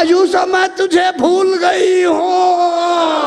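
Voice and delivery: crying shout. Acted. Zulekha's cry.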